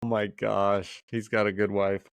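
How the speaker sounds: usual speaking voice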